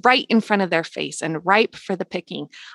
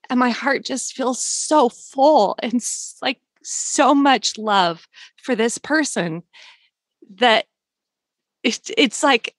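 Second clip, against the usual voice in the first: emotional, slowed; 4 semitones higher, slower than usual. Real, not acted. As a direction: voice thickening with feeling, a bit slower. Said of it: "I'm already getting emotional".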